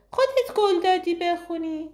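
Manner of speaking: put-on high little girl's voice; child voice. A narrator's reading. Twin reminding her mother of a promise, in a high child's voice. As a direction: say it like a small girl.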